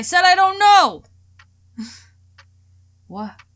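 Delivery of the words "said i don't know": angry shout. Acted. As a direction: yell it back at papa, loud and high. Sophie shouts at her father.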